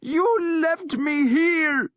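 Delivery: high, slow, loud sobbing accusation; crying accusation. A narrator's reading. Sister accusing her brother through tears of abandoning her. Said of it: "she accused him".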